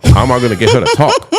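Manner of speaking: real laugh breaking into the words; high and loud, chuckling through it. Real, not acted. Laughs while recalling nervousness.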